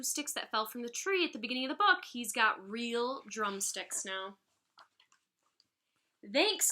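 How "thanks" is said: called out. A narrator's reading. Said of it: "called Max".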